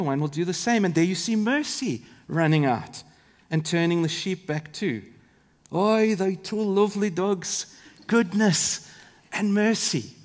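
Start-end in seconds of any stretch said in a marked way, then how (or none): 5.7-10.1 slow, hammed-up Scottish shepherd accent; terrible Scottish accent. Preacher as shepherd admiring two lovely dogs, terrible Scottish accent. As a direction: badly imitate an old Scottish shepherd.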